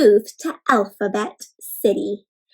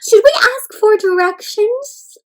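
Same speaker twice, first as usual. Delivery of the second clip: high-pitched eager questioning; high, wide-eyed storybook wondering; wondering questions; 8 semitones higher, 4 dB louder than usual. A narrator's reading. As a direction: fire off anxious-to-know questions in a high voice. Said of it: "question mark wondered what it would be like".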